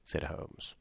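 ordinary delivery, nothing marked

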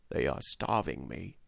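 soft, low, guarded aside; hushed captive's confession; covert answer. A narrator's reading. Captive's covert answer to secret question.

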